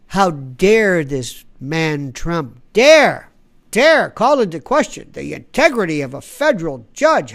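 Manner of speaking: loud, slow, mocking fake outrage; mock indignation. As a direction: ham up the self-righteous anger, stretching dare. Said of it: "feigned, fake, synthetic indignation".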